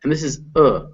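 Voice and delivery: ordinary talk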